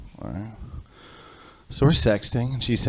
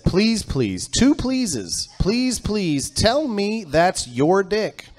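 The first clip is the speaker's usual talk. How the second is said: higher, slow mock begging; pleading father; 9 semitones higher, much slower than usual. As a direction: beg with drawn-out pleases. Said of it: "It said".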